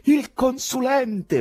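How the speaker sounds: high, loud, slow proclamation; shouted answer. A narrator's reading. Poet answering who has appeared, shouting. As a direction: shout it out over the voices.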